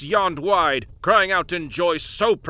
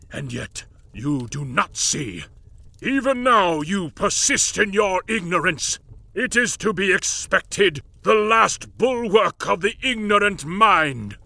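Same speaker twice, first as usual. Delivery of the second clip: scornful lord; 3 semitones higher, 4 dB louder than usual. Acted. Lord Marley lecturing the ignorant.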